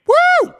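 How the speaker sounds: very high, loud celebratory woo; whoop. Retold or imitated. Celebrating a clean result, loud high whoop. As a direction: whoop in triumph.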